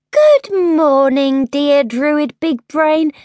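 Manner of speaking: drawn-out, mock courtesy; exaggerated politeness. A narrator's reading. Princess retrying greeting to druid, syrupy. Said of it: "tried again".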